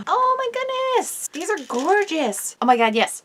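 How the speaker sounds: high, slow gasping delight; shrieking jackpot winner. Real, not acted. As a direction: squeal like you hit the jackpot. Opening gift, jackpot reaction.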